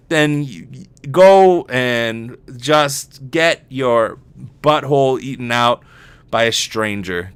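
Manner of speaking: usual speaking voice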